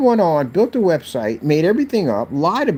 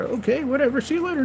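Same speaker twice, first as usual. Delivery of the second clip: much higher, dismissive little voice; squeaky mock voice. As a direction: toss it off in a squeaky high voice. Knock-knock joke, mocking voice.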